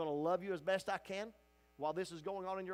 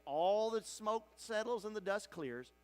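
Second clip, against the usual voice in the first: usual speaking voice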